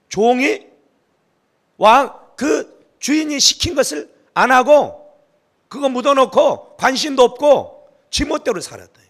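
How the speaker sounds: loud, high, forceful sermon delivery; fiery preaching. Acted. Pastor rebuking the lazy servant to the congregation, in loud, forceful preaching. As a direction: drive the rebuke home to the congregation.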